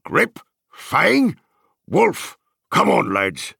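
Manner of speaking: high, loud call to the dogs; loud shout. A narrator's reading. Farmer calling his dogs out at the gate, loud shout. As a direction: shout out each dog's name, high. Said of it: "a loud voice was heard shouting".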